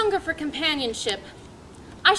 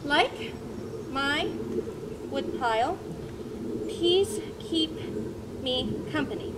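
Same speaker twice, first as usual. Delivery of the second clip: slow, halting, word by word as he writes it down; dictating while writing; 6 dB quieter, slower than usual. Acted. Thoreau saying his journal line aloud as he writes, word by word. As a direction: spell it out as the pen moves.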